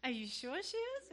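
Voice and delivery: high, disbelieving challenge; retold doubt with pitch pushed up; retold bickering. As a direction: question him hard in a mock squabble. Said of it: "we're fighting in front of this girl".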